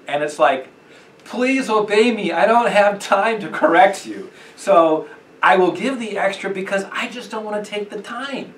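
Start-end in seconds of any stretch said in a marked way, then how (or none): 1.2-7.1 higher, louder, slowed-down plea of a busy parent; mock a rushed mom begging her child to obey; harried parent.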